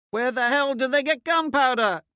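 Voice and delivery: high, loud alarmed shout. A narrator's reading. Soldier under sniper fire shouting in alarm. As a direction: yell it across the plaza under fire. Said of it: "someone shouts".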